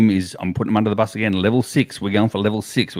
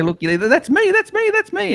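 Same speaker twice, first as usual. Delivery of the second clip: higher and higher, proud; excited bragging. Pretend. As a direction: show off the win. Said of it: "turn around and say".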